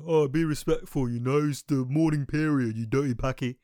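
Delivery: loud, scolding mock complainer; put-on voice of indignant critics; mocked objectors. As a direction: mimic an outraged objector, loudly. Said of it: "I'm gonna get people saying".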